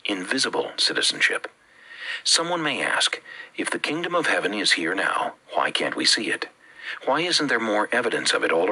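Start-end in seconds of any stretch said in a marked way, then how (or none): none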